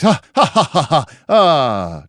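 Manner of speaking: high, loud mock guffaws trailing into "ah"; loud tavern laughter. Game master acting rowdy drinkers in the inn, hearty fake laughter. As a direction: belt out a hammed-up crowd laugh.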